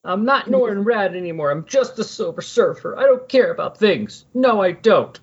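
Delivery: high mock-dramatic protest; Silver Surfer. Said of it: "he's still doing that whole".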